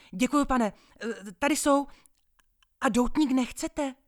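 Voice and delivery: high, loud and keen; eager seller. Acted. Paperboy eagerly offering a cigar, high and loud. Boy eagerly selling to gentleman.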